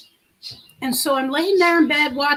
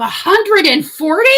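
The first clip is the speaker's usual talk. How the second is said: surprised; 5 semitones higher, 7 dB louder than usual. Real, not acted. Reacting to chat's temperature guess.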